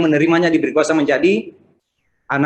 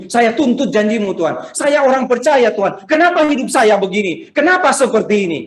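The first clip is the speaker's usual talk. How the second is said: acted-out loud, high-pitched demand to God; demanding complaint; 8 semitones higher, 4 dB louder than usual. Believer demanding God keep his promise, mock indignant complaint. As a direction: mimic someone indignantly demanding answers. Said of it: "bisakah kita bilang ini".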